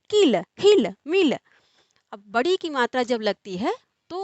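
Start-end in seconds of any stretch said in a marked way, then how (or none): 0.1-1.4 short quick vowels in किल, हिल, मिल; said quickly. Shown as a demonstration. Teacher showing the short इ to students, quick clipped words. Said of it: "शीघ्रता से बोला जाता है".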